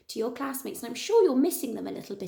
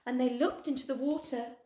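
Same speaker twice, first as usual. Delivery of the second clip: ordinary delivery, nothing marked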